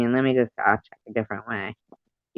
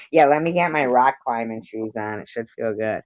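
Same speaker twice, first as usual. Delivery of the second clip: usual speaking voice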